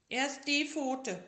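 high, loud, slow demand; bossy sick tiger. Acted. Tiger directs the bandaging, high and slow. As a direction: give orders like a patient. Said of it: "sagte der kleine Tiger".

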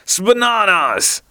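terrible voice. A narrator's reading. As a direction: stretch it out like a comic villain. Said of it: "cried Benny in a terrible voice".

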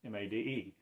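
M A D E spelled letter by letter, soft; quiet spelling of M A D E. Shown as a demonstration. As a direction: spell out M A D E to fix the meaning. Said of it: "maid of Israel".

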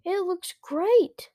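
high, loud, slow insistence; insistent. A narrator's reading. Luke pressing his parents, insistent. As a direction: lean hard on great. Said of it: "Luke insisted".

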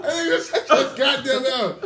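high, loud mock shout; hammed-up telling-off of an employee; retold angry yell. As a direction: comically bellow the order up high. Retold row with boss.

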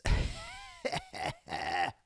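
high laugh after a sigh. Real, not acted. Host laughing after kids interrupt the live stream.

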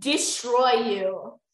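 loud, very drawn-out mock menace; mock threat. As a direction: stretch the threat out with full force.

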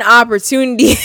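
word breaks into a real laugh. Real, not acted. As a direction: crack up at the end of the word.

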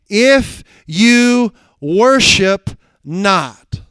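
very slow, loud, each word weighted; preacher stretching the words; drawn-out emphasis. Acted. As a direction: lay heavy stress on If you worship not. Second if, drawn out.

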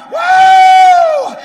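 very loud, high sustained whoop; preacher's whoop. Real, not acted. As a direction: whoop at the top of your range. Preacher whoops mid-sermon.

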